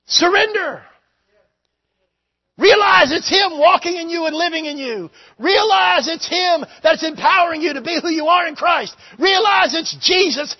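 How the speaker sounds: loud, high revival preacher's shout; full-force preaching. Acted. Preacher urging the church to surrender, full-force shouted preaching. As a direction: drive each phrase out at the top of the voice.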